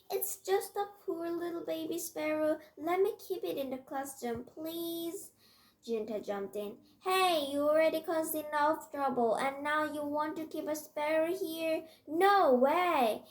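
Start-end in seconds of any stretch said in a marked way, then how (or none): 0.1-5.3 high-pitched little plea; earnest pleading in a raised voice. A narrator's reading. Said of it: "Nami pleaded". 7.0-13.2 loud, raised-pitch scolding; indignant, forceful protest; indignant objection. Acted. Junta jumps in to object.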